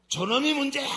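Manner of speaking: high, loud finger-pointing blame; mock accusing outburst. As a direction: burst out blaming someone else. Acted blaming of another person.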